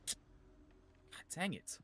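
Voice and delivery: breathy whispered curse. Real, not acted. Muttered frustration.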